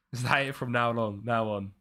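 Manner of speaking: ordinary delivery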